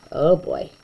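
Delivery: ordinary delivery, nothing marked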